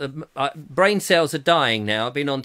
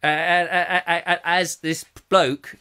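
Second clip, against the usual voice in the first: normal talking voice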